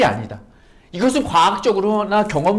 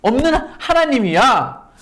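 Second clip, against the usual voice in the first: louder, higher voice of God, slow at the start; God speaking; 6 semitones higher, 4 dB louder than usual. Acted. Preacher voicing God telling Sarah to look at him, louder earnest proclamation. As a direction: call her to look at you with authority. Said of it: "라고 말씀하신다는 거예요".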